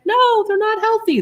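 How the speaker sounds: high-pitched imitated refusal; mock protest. Boyfriend's mother refusing salty food, mock protest.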